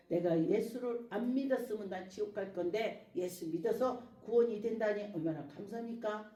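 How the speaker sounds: usual speaking voice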